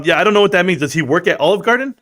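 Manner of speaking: ordinary talk, nothing marked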